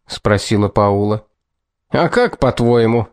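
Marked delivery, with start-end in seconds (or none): none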